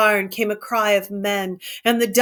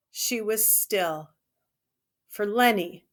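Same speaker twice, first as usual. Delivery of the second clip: ordinary delivery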